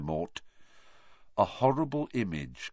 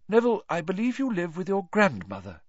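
Lupin; 10 semitones higher than usual. A narrator's reading. Teacher encouraging the class, then calling on Neville.

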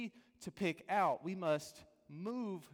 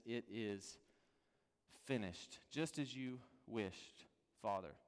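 much softer, lower, falling to almost nothing; hushed last words; 6 semitones lower, 8 dB quieter than usual. Acted. Jesus' final words on the cross, hushed and low. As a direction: let the words fade out like a last breath.

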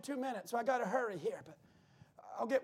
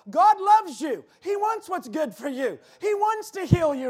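much louder, higher revival call; Brother Branham preaching; 4 semitones higher, 9 dB louder than usual. Retold or imitated. Branham calling out healing to the people, loud. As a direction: boom it out like an old prophet. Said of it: "he's talking like this to the people".

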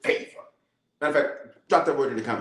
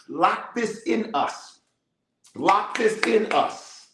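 pastor praying aloud over the church, rising higher; fervent prayer; 4 semitones higher than usual. Acted. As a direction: plead it to God, stretch out each lock this in. Leads congregation in prayer.

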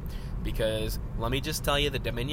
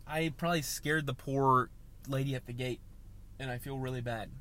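usual speaking voice

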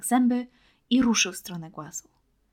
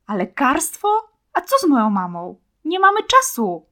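angry protest; 6 semitones higher, 10 dB louder than usual. Acted. Children quarrel in forest.

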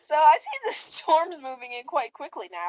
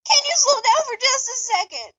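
mild shriek; 10 semitones higher, 3 dB louder than usual. Acted. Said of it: "Trix shrieked".

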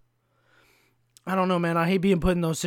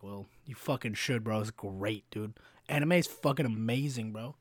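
softly insistent; 6 semitones lower, 6 dB quieter than usual. Retold or imitated. Replying to imagined veteran.

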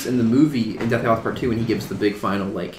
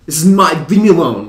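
mock Harry outburst; 4 semitones higher, 8 dB louder than usual. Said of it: "and he'll say".

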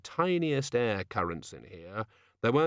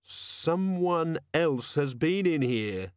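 slow, measured woman's voice; Angua's voice; 6 semitones higher, much slower than usual. A narrator's reading. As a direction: voice her slowly and carefully. Narrator voicing Angua's line.

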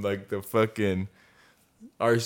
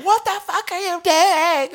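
very high, loud, drawn-out yell; mock crazy screaming; 6 dB louder than usual. Man imitating irate resident over the motor, mock screaming. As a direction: imitate an outraged woman screeching slowly. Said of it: "Going fucking crazy".

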